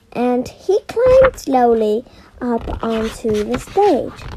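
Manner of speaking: ordinary delivery, nothing marked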